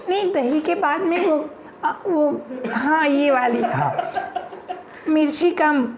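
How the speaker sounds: hint of fussy customer; fussy girl ordering. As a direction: nag the vendor about chillies.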